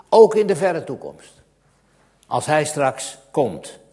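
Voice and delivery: loud, high, impassioned pulpit voice; fervent preaching. Acted. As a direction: preach it out with rising fervour. Preaching at full force. (vurig, luid preken)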